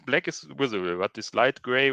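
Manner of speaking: ordinary talk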